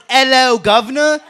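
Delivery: roasting the British, a light mock British accent. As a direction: tease the British accent with a loud "Hello, governor". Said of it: "What is this".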